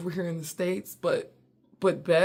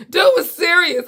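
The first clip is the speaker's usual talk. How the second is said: much higher and louder, laughing while talking; laughing hard; 11 semitones higher, 10 dB louder than usual. Real, not acted. Remembering the serious waiter, laughing out loud. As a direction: crack up at how serious he was. Cracking up at memory.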